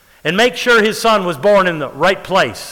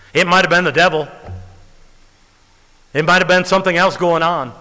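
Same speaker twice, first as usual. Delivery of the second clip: ordinary delivery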